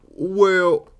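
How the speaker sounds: put-on high, loud Southern old-timer; old Southern accent. Shown as a demonstration. Comic testing an old person voice, Southern accent. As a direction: try out an elderly Southern drawl. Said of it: "is it Southern?".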